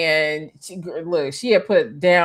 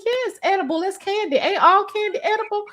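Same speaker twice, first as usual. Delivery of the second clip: naive protest; 13 semitones higher than usual. Retold or imitated. Naive self voiced high.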